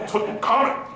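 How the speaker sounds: Tutankhamun squashed, middle syllables swallowed; mock run-together mispronunciation. Teacher mocking how outsiders say it, run-together Tutankhamun. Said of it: "But you wanna say Tutankhamun".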